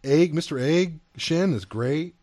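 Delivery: ordinary talk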